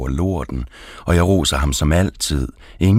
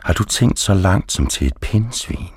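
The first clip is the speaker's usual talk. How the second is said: ordinary delivery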